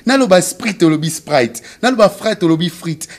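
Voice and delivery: high, loud, hammed-up lady lecturing; high mock correction. Comedian's lady corrects her date loudly and high. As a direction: mockingly insist on proper French, high and loud.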